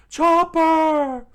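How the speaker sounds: very high, very loud squeaky yell; panicked yell. Acted. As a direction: shriek your friend's name. Usopp yelling for his friend.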